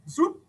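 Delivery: "swoop" flung up high; playful high swoop; high swoop effect. Acted. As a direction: swoop the towel noise up. Sound effect while reading aloud.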